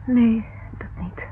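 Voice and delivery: slow hesitant whisper. Acted. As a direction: breathe it slowly, unsure. Secretive reply to John.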